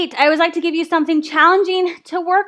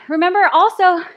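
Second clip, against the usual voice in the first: normal talking voice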